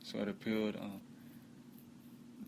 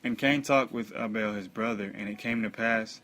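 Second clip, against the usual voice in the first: loud, emphatic Bible reading; solemn scripture reading; 2 semitones higher, 7 dB louder than usual. Acted. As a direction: proclaim the verses in measured phrases. Reads scripture aloud.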